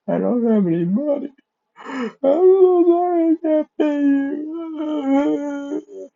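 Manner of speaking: high, slow, wailing cry with breaking voice; hammed-up sobbing apology; mock crying. Broke boy cries to the shopkeeper about money, mock sobbing. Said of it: "he starts to cry".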